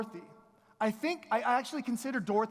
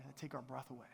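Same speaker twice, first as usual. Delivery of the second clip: normal talking voice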